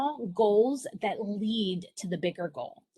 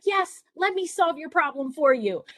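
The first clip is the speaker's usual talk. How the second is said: higher, louder eager rush to help; eager mom; 7 semitones higher, 6 dB louder than usual. Retold or imitated. Mom itching to solve her son's math problem, eager. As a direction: jump in like a mom dying to fix it. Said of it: "the mom in me was like".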